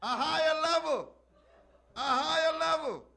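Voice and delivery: high, loud preached shout, slowing on next level. Acted. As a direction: shout it to the rafters. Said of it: "he shouted".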